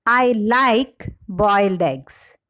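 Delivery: like stressed, then a slight break. Shown as a demonstration. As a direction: lean hard on the word like. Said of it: "the shift in the primary stress".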